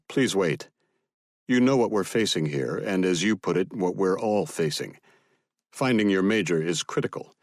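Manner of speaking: ordinary delivery, nothing marked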